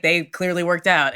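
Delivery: normal talking voice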